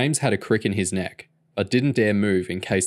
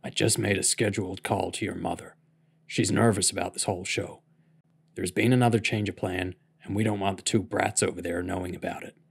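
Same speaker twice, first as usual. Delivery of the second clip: put-on hushed old man; 5 dB quieter than usual. A narrator's reading. Bill hushed talk, sleeping kids.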